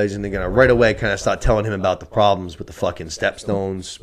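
ordinary talk

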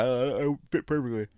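talking through a yawn; words stretched by a yawn. Real, not acted. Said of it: "Oh, that was a horrible yawn".

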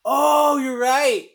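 loud, high cry of recognition; sudden realisation. Real, not acted. Podcaster suddenly recalling a film detail, loud realisation. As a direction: let it dawn on you loudly, pitch jumping.

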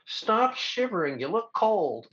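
high, slow, half-laughing director. Retold or imitated. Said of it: "the director goes".

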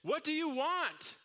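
high, slow yell over the edge; the man yells over the edge at the stranger. Acted. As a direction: shout down in exasperation. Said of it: "Again, he yelled over the edge".

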